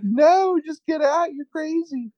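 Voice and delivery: very high imitated woman's voice; mock exasperated yelling. Host as woman ordering crazy man out, mock exasperation.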